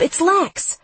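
very high urgent call; screaming. A narrator's reading. Lex announces herself at Damien's door, screaming. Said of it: "Maybe I shouldn't scream".

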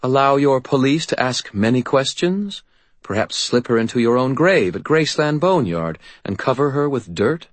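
arch, teasing faerie queen; faerie godmother. Acted.

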